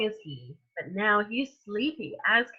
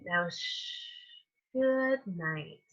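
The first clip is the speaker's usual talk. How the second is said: soft, fading bedtime hush; hushed good night; 3 semitones lower, 7 dB quieter, slower than usual. Acted. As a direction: hush the room before sleep. Putting trucks to sleep.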